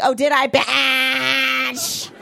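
slow, drawn-out sassy comeback; sassy retort. Retold or imitated. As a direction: drag it out with mock attitude. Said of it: "And I was like".

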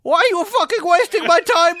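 high, loud mock scammer rage; angry screaming. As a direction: scream it in a put-on Middle Eastern accent. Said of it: "he started screaming at me".

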